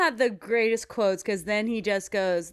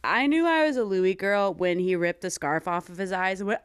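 ordinary delivery

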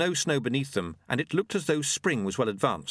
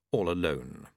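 ordinary delivery, nothing marked

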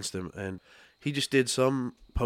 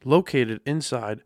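upbeat sponsor read, louder and higher; 3 semitones higher, 5 dB louder than usual. Acted. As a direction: read it like a radio ad.

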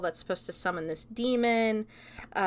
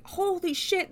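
shocked; 10 semitones higher than usual. Retold or imitated. Boys see snakes in her hair.

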